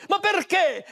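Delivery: high, indignant "perché"; angry demand. Acted. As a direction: throw the why at him in anger. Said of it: "si arrabbiò moltissimo".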